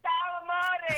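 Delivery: high, drawn-out affectionate hello; gushing greeting. Real, not acted. Caller greets radio host on air, gushing greeting. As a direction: burst onto the line with a big hello.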